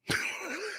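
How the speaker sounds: soft, high wheeze of laughter; helpless laugh. Real, not acted. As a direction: laugh almost without voice. Amused by the Houthis' blunder.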